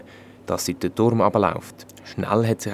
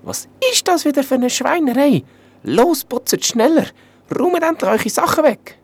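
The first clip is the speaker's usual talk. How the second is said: much higher, loud shrieking scold; scolding like a sparrow; 7 dB louder than usual. Acted. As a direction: rant at the knights like a furious little princess. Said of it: "hat gewettert wie ein Rohrspatz".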